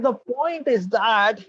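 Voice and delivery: high, slow drawn-out start; hesitant student. Retold or imitated. Shy student hesitating to tell the monk his problem, drawn-out. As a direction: stall before the confession.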